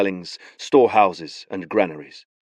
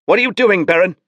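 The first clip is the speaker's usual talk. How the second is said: high, loud alarmed blurt; startled outburst; 11 semitones higher, 7 dB louder, faster than usual. Acted. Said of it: "Givret blurted".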